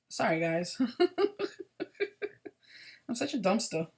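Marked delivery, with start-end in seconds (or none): 0.8-2.5 laughing. Real, not acted. Laughs at own mistake.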